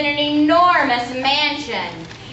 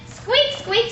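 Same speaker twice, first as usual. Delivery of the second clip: squeaky mouse; 10 semitones higher than usual. Acted. Mouse's note read aloud.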